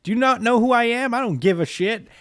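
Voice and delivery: high, loud hero's outburst; defiant mock shout. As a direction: act out a brash, defiant anime hero. Acts out hero's defiant retort.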